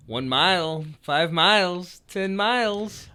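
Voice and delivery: slow, lilting copy of a quiz host; mimicked odd emphasis. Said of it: "Why'd you say it like that?".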